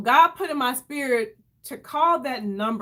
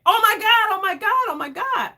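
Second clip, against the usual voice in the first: high, louder burst of disbelief; retold excited scream; 10 semitones higher, 4 dB louder than usual. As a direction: re-enact a scream of shock, pitch way up. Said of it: "I screamed".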